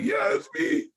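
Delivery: very high, small squeaky answer; retold reply in a tiny high voice; sheepish reply. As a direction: act out a pitiful "it's me". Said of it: "And I'm like".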